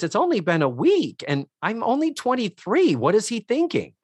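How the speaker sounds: put-on high-pitched young woman voice; imitating Cynthia. Cynthia telling Joe it's been a week, high imitated voice. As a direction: put on a high, incredulous girlfriend. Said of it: "And Cynthia says".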